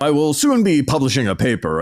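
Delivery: hammed-up pretend-professor voice; mock pseudo-academic. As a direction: play a would-be professor showing off. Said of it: "They talk about their papers all the time".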